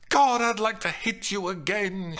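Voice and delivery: high, loud exasperated threat; angry threat. A narrator's reading. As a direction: say it itching to hit him. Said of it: "raising her hand threateningly".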